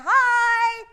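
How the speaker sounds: high, loud wave-to-camera hi; shouted hi. Shown as a demonstration. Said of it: "Everybody look and say".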